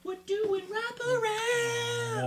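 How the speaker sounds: very high, slow drawn-out squeal. Pretend. Player echoing the wraparound move, drawn-out squeal. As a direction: stretch it out far up in falsetto. Sing-out echo of the card.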